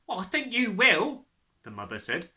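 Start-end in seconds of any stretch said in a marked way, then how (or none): 0.1-1.3 raised, high mother's retort; loud scolding. A narrator's reading. Mother confirms he'll lose his hair, loudly. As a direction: tell him firmly and loudly.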